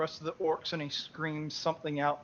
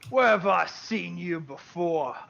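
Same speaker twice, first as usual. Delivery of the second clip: slow, drawn-out loud orc voice; loud orc challenge; 4 semitones higher, 6 dB louder than usual. Acted. Orc chief recognises a dwarf among the party, loud challenge. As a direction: bellow it slowly as a suspicious orc chief.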